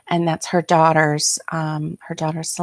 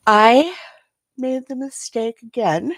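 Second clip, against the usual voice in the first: real yawn stretching the words; talking through a yawn; 5 semitones higher than usual. Real, not acted. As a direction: yawn while you speak. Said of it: "excuse me for yawning".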